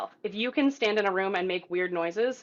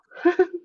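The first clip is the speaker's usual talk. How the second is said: short laugh; 10 semitones higher, 5 dB louder than usual. Real, not acted. Laugh after answer.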